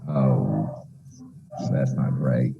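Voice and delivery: ordinary delivery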